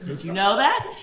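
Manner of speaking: usual speaking voice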